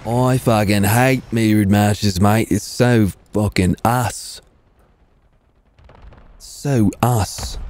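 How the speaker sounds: put-on slow, drawn-out Australian accent; broad Aussie vowels, taken slowly; mock Australian accent. Repeats line as an Australian, "mate.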